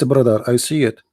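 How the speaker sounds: ordinary delivery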